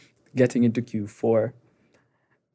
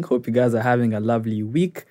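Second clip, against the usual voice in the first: ordinary talk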